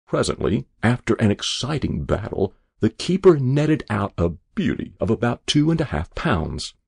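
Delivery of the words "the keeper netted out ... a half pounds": usual speaking voice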